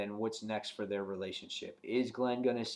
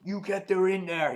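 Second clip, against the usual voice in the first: higher, emphatic imitated accusation; hammed-up argumentative outburst, pitched up; mock accusing yell; 8 semitones higher, 4 dB louder than usual. Shane accusing Herschel at the house, mock angry yell. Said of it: "they're yelling at each other".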